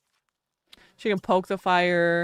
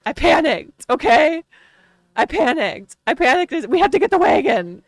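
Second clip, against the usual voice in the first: higher, louder, frantic self-defence; retold panic; 6 semitones higher, 6 dB louder than usual. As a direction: panicky insisting. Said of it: "And I was like".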